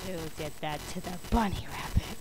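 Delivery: low, hushed threatening question; menacing teacher under the breath; quiet menace. Acted. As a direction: ask it softly and dangerously low.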